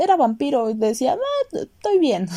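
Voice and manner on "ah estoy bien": put-on high, slow, airy party-girl voice; high, dreamy mock girl voice; carefree party girl. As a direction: play her as blissfully unbothered.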